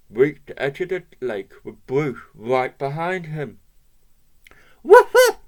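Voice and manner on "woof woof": high loud woof; dog barking. Acted. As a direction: bark a command. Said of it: "barked Bruce".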